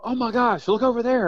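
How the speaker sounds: high, thrilled call to look; excited spotting. Retold or imitated. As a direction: point it out like a giddy kid. Spotting quail, retold excitement.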